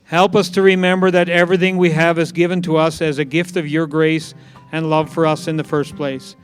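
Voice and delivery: ordinary talk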